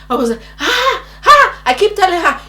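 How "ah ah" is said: two high pained cries; short bursts of acted screaming; re-enacted screams. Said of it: "I was screaming".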